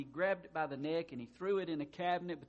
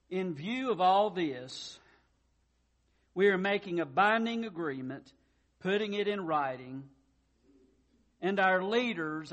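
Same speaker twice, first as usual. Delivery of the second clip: solemn scripture reading; 6 dB louder than usual. Acted. Reads scripture to standing congregation.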